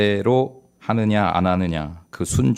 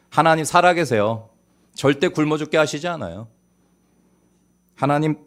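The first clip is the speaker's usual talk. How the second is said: ordinary talk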